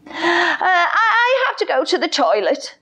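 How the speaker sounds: high, fast, embarrassed giggling confession; giggly lion. Acted. The lion confesses to William he needs the toilet, giggling. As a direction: blurt it out with a nervous laugh.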